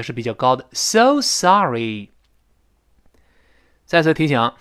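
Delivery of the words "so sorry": "so" pulled up, "sa" of "sorry" high; slowly weight both "so" and "sorry"; stressed so sorry. Shown as a demonstration. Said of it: "so声音往上拔，sorry那个sa也是比较高的". ("so"往上拔，"sorry"重读)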